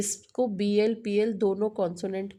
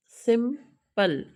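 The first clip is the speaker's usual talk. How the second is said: Simple slowly split into सिम and पल; two separate beats for simple, pausing before पल; syllable by syllable; 3 semitones higher, 3 dB louder, much slower than usual. Shown as a demonstration. Teacher shows students the same case, simple broken into two syllables. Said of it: "same case है".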